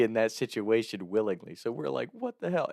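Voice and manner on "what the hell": high-pitched retold disbelief; bewildered shock. Friends react to their buddy tied up, bewildered. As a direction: act out baffled alarm without raising volume.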